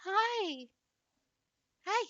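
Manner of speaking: high coo to a dog; pet talk to the dog. Real, not acted. Cooing up at Willow her dog.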